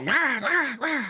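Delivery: high mock caw of crows; imitating crows mobbing a hawk. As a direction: squawk it like a cawing crow. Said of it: "And the crows are all".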